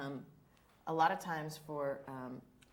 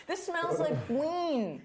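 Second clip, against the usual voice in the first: mock ad voice; 12 semitones higher, 5 dB louder, much slower than usual. Joking mock product slogan.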